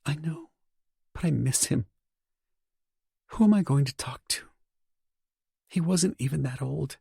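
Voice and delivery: high, hushed woman's voice; grieving wife, hushed and high; whispering wife. A narrator's reading. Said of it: "she whispers".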